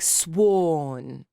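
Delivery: "sworn" drawn out with no r; British vowel drill. Shown as a demonstration. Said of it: "I'm doing it really horribly now".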